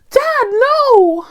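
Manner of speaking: high, drawn-out mock scream; overacted daughter crying out to her dad; Cassie. Reviewer mocking the actress's big scene, mock scream. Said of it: "she was literally like".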